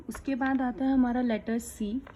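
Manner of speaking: ordinary talk, nothing marked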